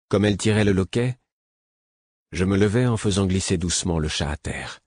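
plain reading voice

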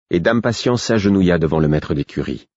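ordinary delivery

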